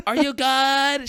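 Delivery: high, drawn-out mimicry; friend's cheery check-in. Friend checks on the suffering rider, high mimicked call. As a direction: imitate a friend calling over, high and slow. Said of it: "And Melly's like".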